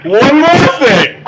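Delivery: high, loud, slow scream. Acted. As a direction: bellow it out. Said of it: "because you're screaming very loud".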